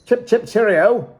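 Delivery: high, loud mock-British cheer; hammed-up British "cheerio"; British accent. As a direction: put on a jolly British accent, pitched high. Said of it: "with a British accent".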